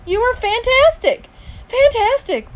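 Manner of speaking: very high, loud gushing; ecstatic praise. A narrator's reading. Parents congratulating Justin after the play, ecstatic. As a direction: squeal the compliment.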